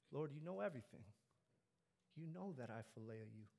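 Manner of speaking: subdued, hurt plea. A narrator's reading. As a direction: sink softly into a wounded admission. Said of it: "Peter was hurt".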